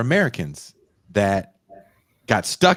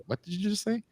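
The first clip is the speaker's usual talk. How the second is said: high, quiet puzzled question; mock confusion at a thick accent; a confused visitor straining to follow Glaswegian; baffled listener; 12 semitones higher, 8 dB quieter than usual. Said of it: "rapping in kind of their Glaswegian accents".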